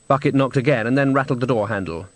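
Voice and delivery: plain reading voice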